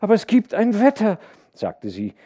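hohe, laute Frauenstimme der Erzählung; besorgte Mutter. A narrator's reading. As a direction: der Familie laut und hoch nachrufen. Mutter ruft dem Vater nach. (besorgte Mutter)

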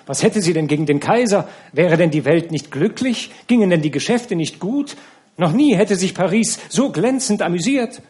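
lauter und höher, aufgebracht durcheinander; stürmisch empört. A narrator's reading. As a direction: wie eine empörte Runde, die dazwischenfährt. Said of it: "unterbrachen sie wieder alle stürmisch". (stürmisch und empört, laut und hoch)

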